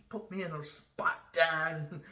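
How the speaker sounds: mock-whine at dad for putting him on the spot; whiny kid voice.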